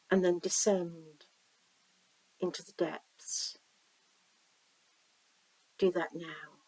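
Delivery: slow-paced visualization reading with long pauses; guided meditation. Acted. Reader guiding listeners down a stream to the sea, guided meditation. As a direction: lead the listener gently, leaving room to imagine.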